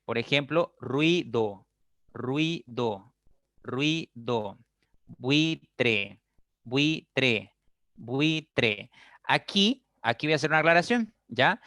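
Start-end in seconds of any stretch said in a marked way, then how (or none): 0.8-4.6 "ruido" split into rui-do, falling; slow pronunciation demo. Shown as a demonstration. Teacher giving a U-I example, "ruido" syllable by syllable. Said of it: "la unión de las vocales U". 5.1-8.9 "buitre" split into bui-tre, falling; slow pronunciation demo. Shown as a demonstration. Teacher giving a U-I example, "buitre" syllable by syllable. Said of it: "la unión de las vocales U".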